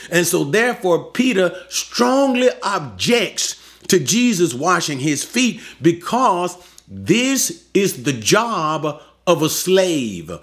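normal talking voice

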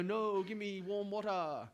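slow, drawn-out mock Chinese accent; bad Chinese accent. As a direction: put on a bad Chinese accent, dragging the words. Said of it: "that was a bad accent".

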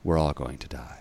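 low, soft, resigned; low deadpan doom. Retold or imitated. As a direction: drop it down like grim fate. Meme doctor's deadpan reply.

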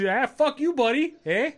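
very high mock heckle with a rising end; comic high-voiced retort; imitated Canadian heckler. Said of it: "It was just so funny 'cause".

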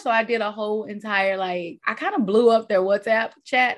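ordinary delivery

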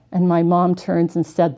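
ordinary delivery

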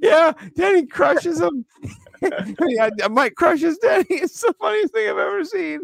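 very high, squealing through laughter; laughing hard. Real, not acted. Telling cohost it's the funniest thing ever, laughing hard. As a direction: barely get the words out for laughing. Cracking up at a meme.

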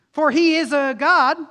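loud, high taunting call; mocking cry. Preacher voicing Elijah taunting Baal's prophets, loud mocking call. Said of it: "Elijah mocks".

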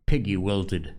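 plain reading voice

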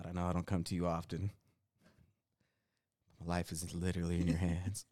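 low, hushed mock prayer; praying. Husband retells praying while driving, low and hushed. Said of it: "I was, like, just praying".

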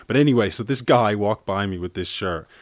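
ordinary talk

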